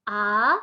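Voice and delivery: A gliding upward; second tone, rising. Shown as a demonstration. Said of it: "Nada kedua". (A dengan nada naik)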